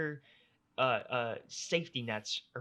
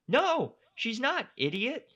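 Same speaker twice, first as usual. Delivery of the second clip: higher, louder and slow, fed up; exasperated; 10 semitones higher, 5 dB louder, slower than usual. Real, not acted. Host answering the clueless doctor, exasperated and slow. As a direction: spell it out to the doctor in disbelief.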